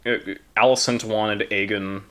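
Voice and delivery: usual speaking voice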